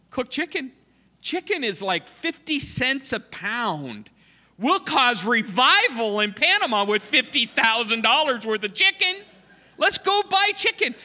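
high, loud, eager outburst; excited retelling. Retold or imitated. Missionary announcing a chicken plan to church, high and loud. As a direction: hype it up like buying the whole market. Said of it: "I'm going".

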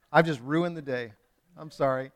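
ordinary talk

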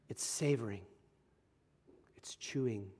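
soft, low, lingering delivery; hushed reflection. Real, not acted. Preacher dwelling on what meditation is, hushed reflection. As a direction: drop low and soft, let each phrase settle.

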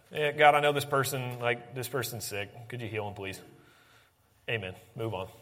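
low, fading, perfunctory mumble of a prayer; half-hearted prayer. Acted. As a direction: pray as if you barely care, trailing away. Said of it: "just to say something half-heartedly".

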